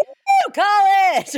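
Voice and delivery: put-on high drunk college whoop; party whoop.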